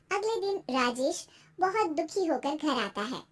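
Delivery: usual speaking voice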